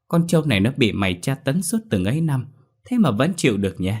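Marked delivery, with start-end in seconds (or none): none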